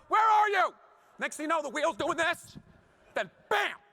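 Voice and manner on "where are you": high, loud cry of sudden alarm; a kid realising Dad let go; panicked child's shout. Retold or imitated. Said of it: "and they go".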